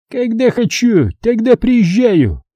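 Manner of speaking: put-on slow, high drunken retort; town drunk. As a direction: slur it like a drunk boss. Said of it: "Пьяно возразил комбат".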